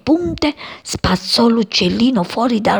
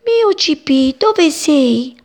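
higher, anxious mother calling; a touch of desperate call; 8 semitones higher, 4 dB louder, slower than usual. Acted. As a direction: search for the lost chick. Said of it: "lo chiamò disperata".

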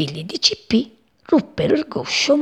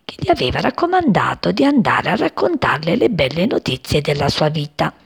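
plain reading voice

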